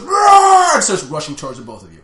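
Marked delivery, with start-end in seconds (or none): 0.0-0.7 high, loud shouted roar; monster roar. Acted. Giant monster charging players, loud shouted roar. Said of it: "the monster goes".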